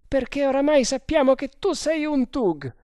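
high, loud character voice; accusing sergeant. Acted. Sergeant telling prisoner he is unmasked, accusing.

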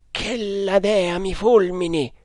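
high-pitched exclamation, no added volume; mild cry of alarm. A narrator's reading. One Indian to the other on spotting the enemy, mild cry. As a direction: cry out high but held in. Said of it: "gridò uno dei due indiani".

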